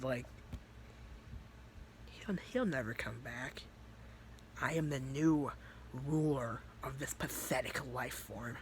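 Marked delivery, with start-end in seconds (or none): 2.1-8.6 soft, grave pronouncement; deadpan demon. Acted. Demon Vice claiming the body from Natsuki, deadpan. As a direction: declare dominion quietly and without fuss. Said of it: "dead out said".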